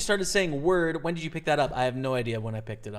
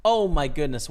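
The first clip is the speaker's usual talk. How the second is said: stunned; 8 dB louder than usual. Real, not acted. Reacting again to river.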